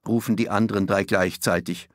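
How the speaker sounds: ordinary delivery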